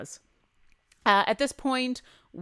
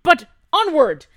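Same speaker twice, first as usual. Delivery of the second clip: loud, high showman's call; grand call onward; 8 semitones higher, 13 dB louder than usual. Acted. [name] loudly moving the party on to the next cart. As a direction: sweep them on to the next cart. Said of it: "[name] moving them on".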